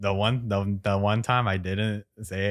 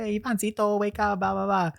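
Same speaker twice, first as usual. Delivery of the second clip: high-pitched mom wake-up call; sing-song mom waking her kid; imitating mom; 11 semitones higher than usual. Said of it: "And she's like".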